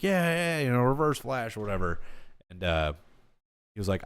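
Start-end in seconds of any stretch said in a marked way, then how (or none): 0.0-2.0 high, casual dismissive quote; offhand. Retold or imitated. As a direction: shrug it off.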